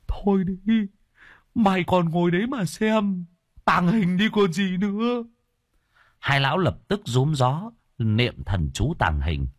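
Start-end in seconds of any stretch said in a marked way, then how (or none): 0.1-5.2 much higher, squeaky companion voice; high-voiced sidekick's urgent nagging; scolding old crony. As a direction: hurry a friend along in a high pitch.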